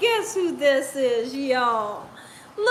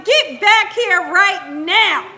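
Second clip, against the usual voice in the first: loud, slow, every word laid down; mock stern command; 6 dB louder, slower than usual. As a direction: play the strict parent calling him back. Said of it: "Do y'all see how I'm talking to him?".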